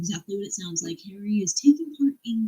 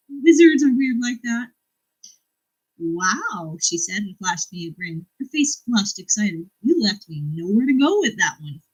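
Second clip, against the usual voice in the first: louder, lively reading of a joke exchange; reading a book aloud; 3 semitones higher, 5 dB louder than usual. Acted. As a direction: read it with comic punch. Reads a funny exchange.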